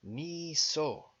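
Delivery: "Ni So", both vowels held long; careful sounding-out. Shown as a demonstration. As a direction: sound out "Ni So" syllable by syllable. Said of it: "phonetically sound out all these numbers".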